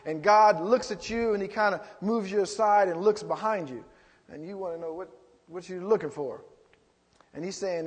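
ordinary talk, nothing marked

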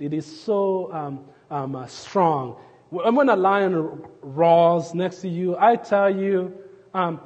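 ordinary talk, nothing marked

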